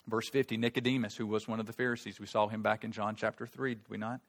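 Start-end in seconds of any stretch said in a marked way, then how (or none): none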